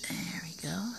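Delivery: soft whisper. Real, not acted. Gardener framing the camera shot, whispering. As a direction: hush it under the breath.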